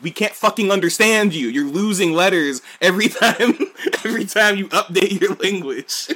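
loud, high, laughing exasperation; exasperated outburst. Retold or imitated. Americans yelling at British about dropped letters, loud and laughing. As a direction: yell at the British, half laughing. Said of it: "And people are like".

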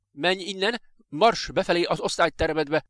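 high, forceful scolding; gruff snapped order. A narrator's reading. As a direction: snap at the child to leave. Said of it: "mordult rá az odaérkező mister Phillips".

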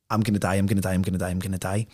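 low, monotone muttered loop; retold dread. Retelling his thoughts while lifted by giant hands, low dread. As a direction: repeat it under your breath like a droning mantra.

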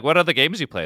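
recalled eager question at a party. Retold or imitated. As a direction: ask it brightly and keen. He recalls asking streamers.

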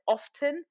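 "often" with the T sounded, as OF-ten. Retold or imitated. Telling friend what she said at table, often with sounded T. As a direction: say "often" as two beats, OF-TEN. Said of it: "But I say often".

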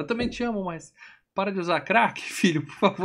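high-pitched mock parent voice; pleading parent. Podcaster voicing parents begging their son to stop, mock pleading. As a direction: plead comically, close to laughing.

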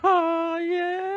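put-on high echo of a kid's call; mock kid voice. Friend echoes the son's reply, high mock kid voice.

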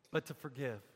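sudden drop to low and soft; quiet resolve. Acted. Preacher voices Stephen resolving to forgive, quiet and low. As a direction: let the outcry fall away into hushed surrender. Acted resolution dropping after outcry.

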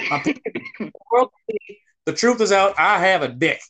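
high, far-off proclaiming voice; mock grand announcement. Friend mock-proclaiming his secret, hammed-up high announcement. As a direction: announce it like a town crier, hammed-up.

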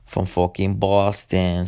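slow, hammed-up Southie Boston accent; a self-mocking, overdone Boston accent; bad Boston accent. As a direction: lay on a clumsy Boston accent, dragging the words. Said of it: "Sorry, fucking horrible accent".

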